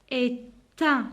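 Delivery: "Est un" with the T sounded; "Est un", T carried onto the vowel; liaison. Shown as a demonstration. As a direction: split slowly as é-tun. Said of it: "la liaison entre le T et le A".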